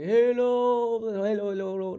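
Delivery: hello repeated, each fainter like an echo; echo imitation. Shown as a demonstration. Showing viewers how a long delay echoes, with mock fading echoes. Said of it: "Ví dụ như là".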